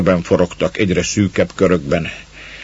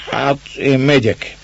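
slow, higher hoarse growl; hoarse grumble; 7 semitones higher, slower than usual. A narrator's reading. Man leaving the hut, hoarsely grumbling. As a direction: grumble the goodbye roughly. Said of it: "morogta rekedten".

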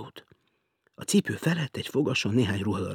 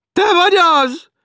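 much higher, loud shrill call; woman calling; 13 dB louder, slower than usual. Acted. Woman below checking who is there, shrill call.

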